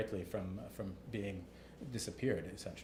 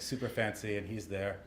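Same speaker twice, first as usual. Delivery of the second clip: ordinary delivery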